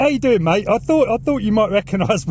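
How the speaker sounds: normal talking voice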